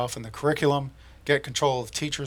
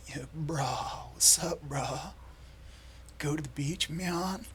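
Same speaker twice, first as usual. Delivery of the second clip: laid-back surfer bro, pitched up; lazy beach bro drawl; surfer dude voice; 3 semitones higher, 6 dB quieter than usual. Pretend. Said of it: "I could be an undercover surfer guy like".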